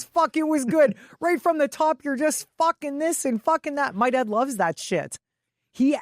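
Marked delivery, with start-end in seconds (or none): none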